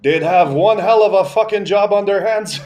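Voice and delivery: high, loud comic retort; imitated grandpa. Grandpa shoots back at grandma's joke, loud imitated retort. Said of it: "And my grandpa's like".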